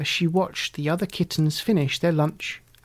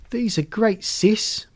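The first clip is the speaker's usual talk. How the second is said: happy brother; 6 semitones higher, slower than usual. A narrator's reading. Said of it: "Bugsy said".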